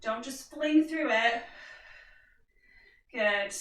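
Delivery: ordinary talk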